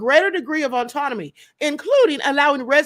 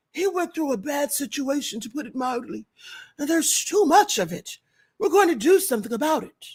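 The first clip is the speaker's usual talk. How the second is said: Trump impression. Vlogger quoting Trump to her audience, light mock voice. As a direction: loosely mimic Trump's cadence. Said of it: "The president said".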